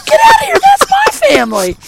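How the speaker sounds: high, loud cry of disbelief; astonished outburst. Retold or imitated. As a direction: blurt it out, amazed at the coincidence. Retells his astonished outburst at coincidence.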